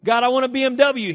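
high, loud mock tantrum; hammed-up insistent plea; bratty demand. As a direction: demand it like a spoiled child. Acted demanding prayer to God.

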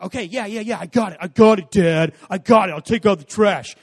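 loud, exasperated brush-off; annoyed teen. Retold or imitated. Teenage son waving off his dad, loud and exasperated.

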